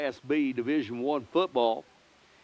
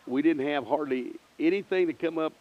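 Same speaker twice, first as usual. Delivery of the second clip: normal talking voice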